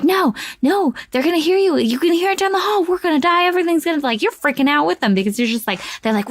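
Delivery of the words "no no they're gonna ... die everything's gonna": high, retold panic; imitated freak-out. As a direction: act out a panicking character, pitched way up. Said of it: "Daniel Radcliffe's freaking out".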